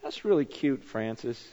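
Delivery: a hint of God teasing softly; lightly voiced God chuckling at Francis; God gently teasing Francis at the beach; gently amused God. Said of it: "God was looking down and going".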